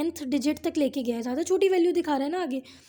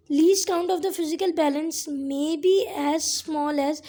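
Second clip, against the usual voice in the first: slow textbook reading; much slower than usual. Shown as a demonstration. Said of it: "reading textbook line slowly".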